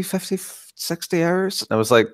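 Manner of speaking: ordinary talk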